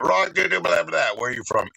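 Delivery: high, loud mock chatter; mimicking the men from the store, high and loud; mocking tourists. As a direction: parrot them in a high silly voice. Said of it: "They were like".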